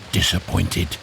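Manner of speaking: ordinary delivery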